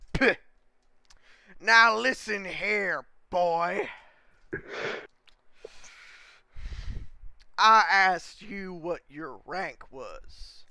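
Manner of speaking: put-on loud, slow, stern old commander drawling at a recruit; gruff commander. Commander scolding the new recruit, stern loud drawl. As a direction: bark it down at the new boy like a drill sergeant.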